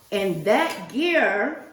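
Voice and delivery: ordinary delivery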